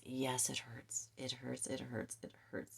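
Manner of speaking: soft repeated whisper, fading out; whispered empathy. Real, not acted. Reader tells Aquarius the truth hurts, whispering softly. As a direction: hush it down, gently sharing the pain.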